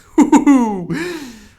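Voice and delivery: high, loud burst of laughter; real laugh. Real, not acted. The reader breaks up laughing at her own voice. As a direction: crack up at the voice.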